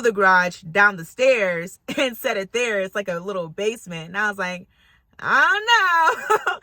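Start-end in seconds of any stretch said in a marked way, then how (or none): 5.2-6.6 high, drawn-out, wailing reluctance; comic, loud sing-song misgiving; wary hesitation. Retold or imitated. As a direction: stretch it up high and doubtful. Wary reaction to the basement.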